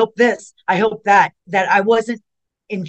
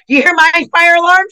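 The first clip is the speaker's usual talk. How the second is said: higher and louder, startled by the alarms; jolted mid-story, voice jumps up; 11 semitones higher, 8 dB louder than usual. Real, not acted. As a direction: caught off guard by blaring fire alarms. Alarms suddenly going off.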